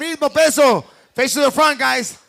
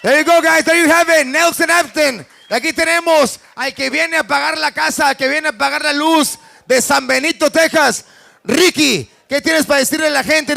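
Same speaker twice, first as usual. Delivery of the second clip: louder fight announcer hype; 3 semitones higher, 4 dB louder than usual. Real, not acted. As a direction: sell the fighter to the crowd. Hype host introducing fighters.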